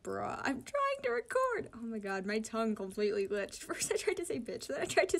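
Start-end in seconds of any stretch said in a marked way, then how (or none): 0.0-1.7 high, slow exasperated complaint; a mock-fed-up plea pitched up high; exasperated bruh. Vlogger scolding her noisy cats, high and exasperated. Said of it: "And I'm just like".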